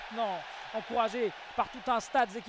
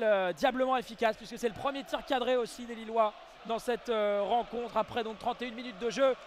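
ordinary talk

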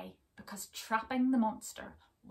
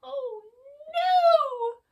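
very high, loud squeal of despair; Pig crying out; 15 dB louder than usual. Acted. Pig crying out in despair at the silent door. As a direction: shriek it like a panicked little pig. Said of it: "cried Pig in despair".

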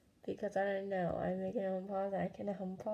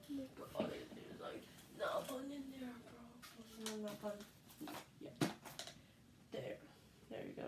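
mutter; 12 dB quieter than usual. Real, not acted. Muttering at game.

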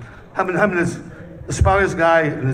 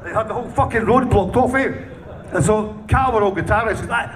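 ordinary delivery

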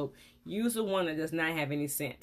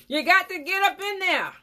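much higher and louder insistence; emphatic shout; 11 semitones higher, 8 dB louder than usual. Real, not acted. Dentist insisting viewers scrub the appliance, loud and high. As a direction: hammer home the instruction.

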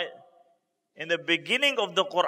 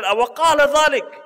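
startled question; 5 semitones higher, 8 dB louder than usual. Retold or imitated. Abu Bakr hears the news.

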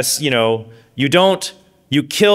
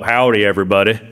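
Texan howdy; 6 semitones lower, faster than usual. Retold or imitated. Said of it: "envious of his and others' accents".

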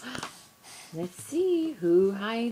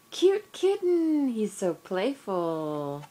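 excited; 7 dB louder than usual. Real, not acted. Reading picture book to baby.

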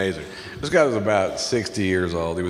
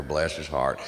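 ordinary talk, nothing marked

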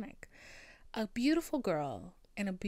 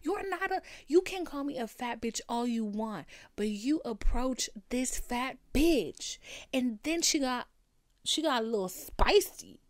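loud, fast heated rant; heated yelling; 4 semitones higher, 6 dB louder than usual. Retold or imitated. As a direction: tear into him. Retelling a shouting match.

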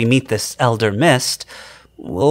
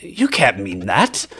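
usual speaking voice

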